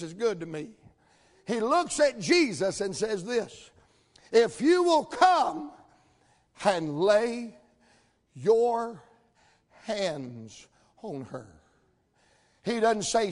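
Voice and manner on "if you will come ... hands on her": emphatic plea. Retold or imitated. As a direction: plead in broken phrases, long pauses between them. Jairus's statement preached with slow emphasis.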